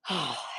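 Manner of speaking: low, soft heavy sigh; sad sigh. Real, not acted. Streamer grieving the girl's story, sad sigh. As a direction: let out a quiet, weary sigh. Saddened after talking about the girl.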